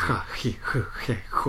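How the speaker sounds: は ひ ふ へ ほ airy and breathy; airy breathy Spanish はひふへほ. Shown as a demonstration. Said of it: "空気抜けた感じ。".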